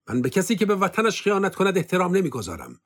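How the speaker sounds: scornful reply to the officer; defiant retort. Acted. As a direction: fling it back at him. Stefan retorts with contempt.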